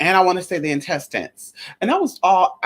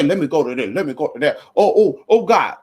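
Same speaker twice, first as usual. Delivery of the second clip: hammed-up panicky moaning; mock panic; 4 semitones lower than usual. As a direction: act out a mock meltdown. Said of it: "I didn't say".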